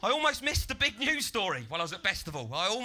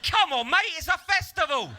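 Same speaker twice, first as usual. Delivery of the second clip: high, loud goading shout; pushy festival-goer; 11 semitones higher, 8 dB louder than usual. Acted. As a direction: bellow it like a reveller brushing off blame. The stamper's carefree retort.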